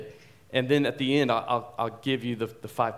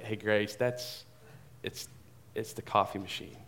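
much softer, calming voice; soft reassurance; 4 semitones lower, 10 dB quieter than usual. Retold or imitated. Dad reassuring his frightened daughter, soft and calm. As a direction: gently settle her fear.

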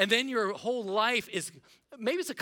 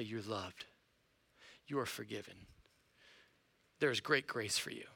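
much softer, lower, gentle words of grace; tender assurance; 7 semitones lower, 7 dB quieter than usual. Acted. God's word speaking to the ashamed father, soft and tender. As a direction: speak it like forgiveness to a shamed man.

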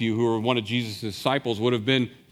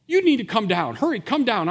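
urgent call; 9 semitones higher, 3 dB louder than usual. Acted. Jesus urging him down.

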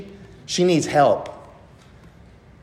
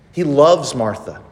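usual speaking voice

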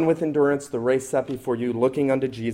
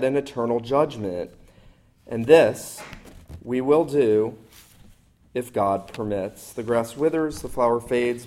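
measured, solemn reading of Scripture; 3 semitones lower than usual. Acted. Preacher reading Hebrews to the congregation, solemn scripture reading.

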